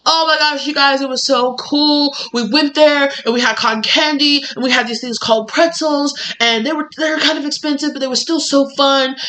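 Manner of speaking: high-pitched, gushing rave about a fun day; gushing excitement. Pretend. Back home telling friends about the theme park, gushing. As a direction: bubble over like a thrilled kid back from the park. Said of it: "go back and be like".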